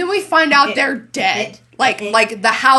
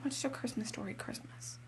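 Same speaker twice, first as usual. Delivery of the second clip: whisper; 23 dB quieter than usual. Real, not acted. Sheepish confession of holiday movies.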